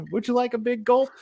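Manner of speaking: lightly put-on, high-pitched offer; mock Mankind offer. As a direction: offer it in a hint of a character voice. Imitates Mankind offering the drink.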